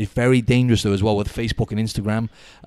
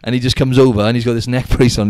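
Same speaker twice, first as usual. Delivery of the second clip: ordinary delivery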